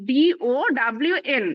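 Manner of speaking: "ডিওডব্লিউএন" spelled out letter by letter, very slowly; slow spelling out. Shown as a demonstration. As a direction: drag each letter of "ডিওডব্লিউএন" out evenly. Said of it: "দুইবার focus করছি". ("ডিওডব্লিউএন" ধীরে ধীরে বানান করে)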